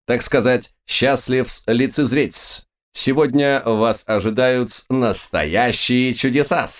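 high, slow grand showman; booming circus ringmaster. Acted. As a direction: proclaim the show to the crowd. Magician addresses the audience.

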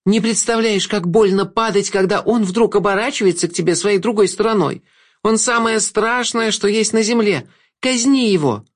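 high, vehement female voice; high-pitched anguished accusation; Agnessa. A narrator's reading. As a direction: press the demand with high, sustained urgency. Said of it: "Голос принадлежал Агнессе".

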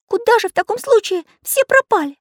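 very high, loud character voice; worried little artist. A narrator's reading. Little artist asks craftsman about the children, high and worried. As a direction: pipe up high and fretful. Pencil's voice, fretting over missing children.

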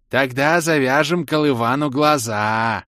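high, loud, slow and delighted; stretched high-pitched excitement; gleeful proposal. A narrator's reading. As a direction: propose mischief with drawn-out glee. Orbit gleefully proposes a wild idea.

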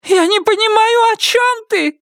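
the wife yelping in pain; cries of pain. A narrator's reading. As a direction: squeal as he pulls her hair. Myrtle bewildered.

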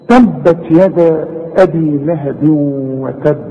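slow recitation, doubled ب of وتب held then bounced; strong qalqalah. Shown as a demonstration. Teacher reciting an example of shadda, heavy bounce on وتب. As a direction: press and release the b at the end of وتب. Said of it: "شوف البه ده عاملة إزاي؟".

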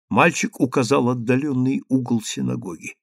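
ordinary delivery

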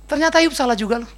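ordinary talk

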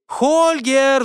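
high, drawn-out call. A narrator's reading. Rider looking for Holger, calling out. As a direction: call his name as if searching. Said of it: "звала она".

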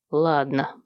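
low, resigned concession; sighing. A narrator's reading. Father giving up the scolding, sighing. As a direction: give in with a sigh. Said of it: "вздохнул он".

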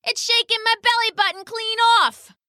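high, whining hungry bird; whiny hungry pheasant. Acted. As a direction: moan about hunger up high. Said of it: "said the pheasant".

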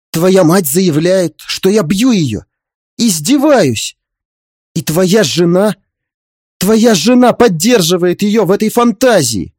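high, slow, indignant complaint; emotional exclamation. Acted. Father-in-law complaining to son about the women, emotional. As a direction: draw out each accusation with wounded outrage. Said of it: "Эмоционально воскликнул этот оборотень".

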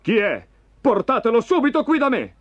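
high, urgent king's order; cried command. A narrator's reading. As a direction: call out impatiently to servants. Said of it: "gridò Saul".

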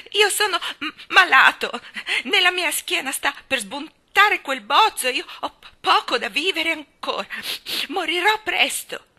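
high, loud sobbing lament, broken words; tearful wailing. Acted. Colin cries that he will die, wailing. Crying child pities himself.

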